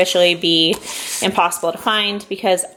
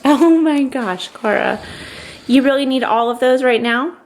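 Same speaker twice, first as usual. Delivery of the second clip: exasperated; 5 semitones higher than usual. Real, not acted. Exasperated at daughter.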